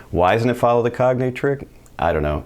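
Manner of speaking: ordinary delivery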